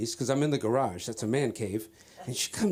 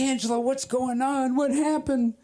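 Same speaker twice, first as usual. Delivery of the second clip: high-pitched mock wife voice; alarmed wife; 10 semitones higher than usual. Wife asking fleeing husband what happened, high alarm. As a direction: imitate a worried wife, pitched way up. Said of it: "And she said".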